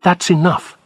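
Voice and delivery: a nurse cutting the police off firmly; a touch of an angry nurse. A narrator's reading. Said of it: "she said angrily".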